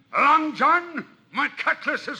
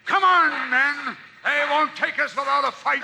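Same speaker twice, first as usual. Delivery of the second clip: ordinary talk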